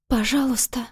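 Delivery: faint rasping plea, barely voiced; death rattle. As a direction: beg with the last breath. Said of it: "больше похожий на предсмертный хрип".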